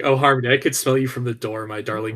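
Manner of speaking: mock suave Mickey. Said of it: "he's like".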